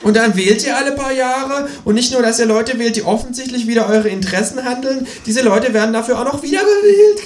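the kangaroo mocks the voters, croaking high. A narrator's reading. Kangaroo rants at the narrator, croaking high. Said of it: "krächzt das Känguru".

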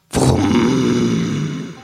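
long revving engine imitation; mouthed motor noise; lawnmower roar. A narrator's reading. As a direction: rev it with a long humming vroom.

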